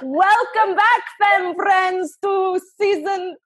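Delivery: put-on a Scottish accent that drifts into something else; failed Scottish accent. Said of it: "I'm sounding like something else".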